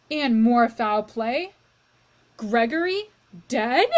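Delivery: loud, slow, shocked outcry; shouting. Acted. Cook shouting in dismay over Gregory's death, loud. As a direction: shout the news out in horror. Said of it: "shouted Cook".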